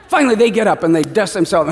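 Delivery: normal talking voice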